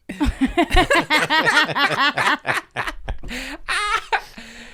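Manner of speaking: sustained, helpless laughing fit; long real laugh. Real, not acted. Co-host cracking up at a dark joke, long laugh.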